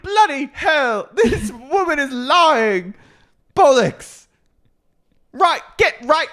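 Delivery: very high, slow, laughing British outrage; hammed-up British swearing through giggles; mock British. Co-host riffing as Meredith, shrieked mock British accent. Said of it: "goes back into a British accent".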